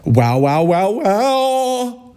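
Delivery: rising high mocking wows; mock it, climbing high; sing-song high mock wow. Mocking the comment.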